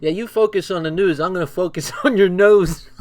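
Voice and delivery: mocking comeback quote. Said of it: "Then the one guy says".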